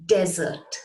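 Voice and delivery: "desert" as DEZ-ert, first syllable stressed. Shown as a demonstration. Teacher shows how it is pronounced, "desert" as DEZ-ert. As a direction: land on DEZ in "desert", then let it fall. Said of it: "pronounced as".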